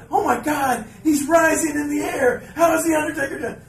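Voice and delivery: yelling way up high, astonished; crazed excitement. Retold or imitated. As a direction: shout it like the levitation is really happening. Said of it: "Michael Cole's going crazy".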